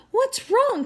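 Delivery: high concerned call. A narrator's reading. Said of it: "called Mister Hopper".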